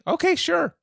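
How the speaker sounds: high chipper okay. Retold or imitated. Agreeing eagerly to client.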